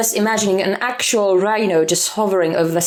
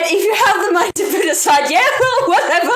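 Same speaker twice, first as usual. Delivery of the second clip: laughing; 7 semitones higher, faster than usual. Real, not acted. Laughing off the expensive preorder.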